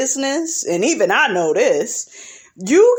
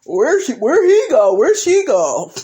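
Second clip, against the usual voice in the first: confused coworkers; 5 semitones higher, 3 dB louder than usual. Retold or imitated. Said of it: "They gonna be like".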